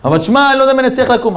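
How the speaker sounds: high, hurried pleading voice; worried objection. Retold or imitated. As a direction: act out a reluctant guest making excuses. Acted doubt about waking up.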